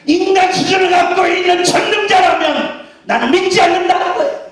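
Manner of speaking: high, forceful preaching peak; real pulpit shout; emphatic declaration. Real, not acted.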